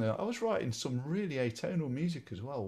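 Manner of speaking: usual speaking voice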